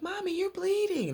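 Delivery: very high put-on child voice; little girl. Little girl to her bleeding mother, high child voice. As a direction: pitch it way up as a little daughter.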